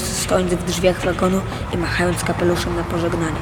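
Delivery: plain reading voice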